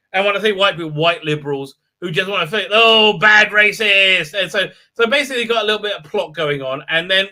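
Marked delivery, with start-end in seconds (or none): 2.7-4.3 slow, loud, drawn-out mockery; hammed-up scolding, stretched out; mock reproach. Mimics liberals' reproach.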